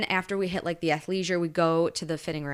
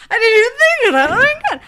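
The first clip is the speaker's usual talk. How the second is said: much higher and louder, fast panicked squeal; panicked realization; 12 dB louder, faster than usual. Retold or imitated. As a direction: shriek it out in the sudden realization. Panicked realization retold to friends.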